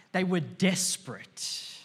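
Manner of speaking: ordinary talk, nothing marked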